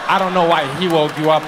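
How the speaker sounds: usual speaking voice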